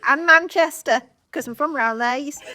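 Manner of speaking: put-on a light Brummie accent; hint of a Birmingham accent. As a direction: slip into a mild Birmingham accent. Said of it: "you can do that accent".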